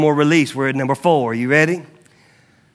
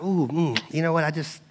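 soft, hesitant, gentle correction; softly acted loving hesitation; 8 dB quieter than usual. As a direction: ease into a tender, tactful reproof. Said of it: "very lovingly be like".

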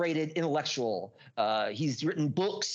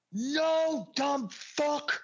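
high, drawn-out retold battle cry; unleashed power; 11 semitones higher, much slower than usual. Confronting his brother in the living room, high powerful cry. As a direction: hurl the words out high, unleashing stored-up power. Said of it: "unleashed all of that fucking just power onto him".

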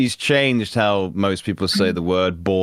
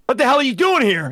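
high, loud guard yelling at an intruder; mock furious guard, pitched high; hammed-up guard shout; 4 dB louder than usual. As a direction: bark it like a game guard catching someone. Guard line escalating.

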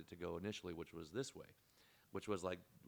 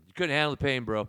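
loud teasing jab, pitched up; mocking taunt; 6 semitones higher, 16 dB louder than usual. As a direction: rub it in, laughing at his wimpiness. Teasing friend about tattoo pain.

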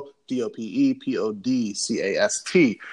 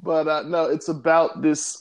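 usual speaking voice

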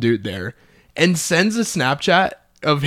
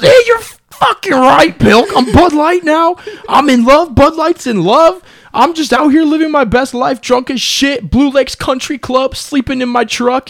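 put-on much higher and louder, slow, sloppy drunk bro bragging; drunk friend voice; 13 semitones higher, 8 dB louder than usual. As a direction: ham up the happily wasted buddy who is in love. Friend voiced as drunk Bud Light.

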